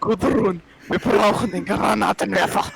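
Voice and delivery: German-language line in a put-on accent; mock stern German. Shown as a demonstration. Showing off German to the table, scary mock accent. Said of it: "how to do a German accent".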